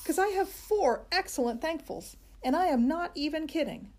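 put-on high, eager child voice; bursting to tell the teacher; excited kid. A narrator's reading. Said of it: "Junie B. eager to share".

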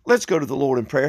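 normal talking voice

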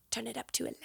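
a hint of an impression, soft; quiet, lightly voiced movie quote; quoting The Office. Shown as a demonstration. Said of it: "My dialect is a little off on that".

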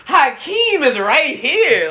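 high, drawn-out disbelief; acted-out incredulous objection; incredulous protest. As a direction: protest it high and slow, as if nobody remembers. Acted disbelief at best-ever claim.

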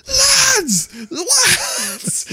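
high frantic mock call across a pub. Joe shouting for his friends while searching pubs. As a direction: call for your mates, desperate.

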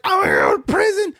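high-pitched mock-tearful panic; panicked, mock. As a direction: wail your worst fears like a hammed-up crybaby. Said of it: "I was shitting a brick".

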